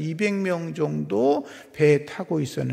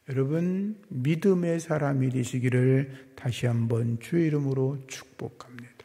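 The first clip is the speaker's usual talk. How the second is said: normal talking voice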